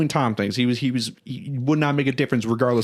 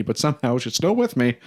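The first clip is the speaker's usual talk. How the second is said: ordinary delivery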